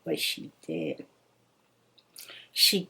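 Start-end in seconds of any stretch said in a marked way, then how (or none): 0.0-1.0 much lower and softer, a dark aside; low murmured echo. Shown as a demonstration. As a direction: drop the voice low for an ominous echo.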